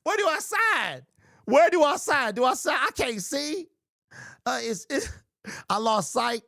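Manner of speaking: high, whiny fake-injury voice; hammed-up injured victim. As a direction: ham up a fan faking injuries for a payout. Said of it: "I would have been like".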